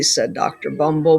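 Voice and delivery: ordinary delivery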